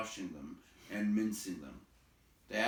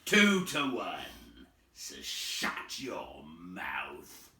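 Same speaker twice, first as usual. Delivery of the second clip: lower, slow troll threat; menacing troll; 6 semitones lower, 3 dB louder, much slower than usual. A narrator's reading. Bert silencing the others, slow. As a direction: growl it slowly. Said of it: "said Bert".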